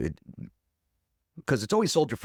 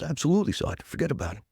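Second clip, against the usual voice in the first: put-on "Absolutely" pitched up, light New York accent; 7 semitones higher, faster than usual. A recruit answers his sergeant in a light New York accent. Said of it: "Really talk like that?".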